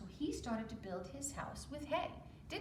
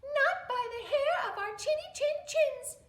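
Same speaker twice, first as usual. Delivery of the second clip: much higher, louder little pig; squeaky defiant pig; 9 dB louder than usual. Acted. Little pig defying the wolf through the door, high and squeaky. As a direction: squeak out the refusal. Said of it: "said the pigs".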